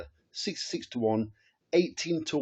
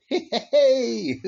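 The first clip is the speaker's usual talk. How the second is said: gleeful laughing exclamation; laughing hey; 10 semitones higher, 7 dB louder than usual. Real, not acted. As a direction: burst of laughter into a high, loud hey. Laughs after profitable day.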